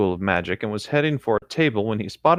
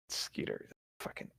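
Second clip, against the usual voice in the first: whisper; 21 dB quieter than usual. Real, not acted. Muttering the name to himself.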